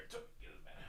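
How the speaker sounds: unvoiced order to the dog; a soft whispered order to Toby. Real, not acted. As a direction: hush the dog into bed.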